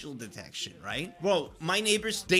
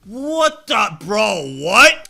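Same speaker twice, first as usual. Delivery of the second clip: shocked; 4 semitones higher, 13 dB louder, much slower than usual. Real, not acted. Reacting to sprickets.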